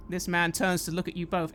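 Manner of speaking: normal talking voice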